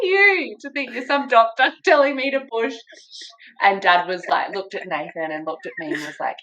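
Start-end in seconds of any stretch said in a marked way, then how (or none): 0.0-2.7 high-pitched, laughing retort; mock indignation. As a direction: challenge him incredulously, amused in the retelling. Retold indignant retort to partner.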